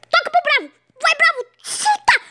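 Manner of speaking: high, sharp shout to shoot; high urging shout. Real, not acted. Gamer urging his striker on, high excited shout. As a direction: yell at the player to shoot now.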